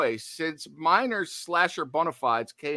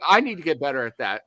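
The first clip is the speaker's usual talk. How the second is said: ordinary talk, nothing marked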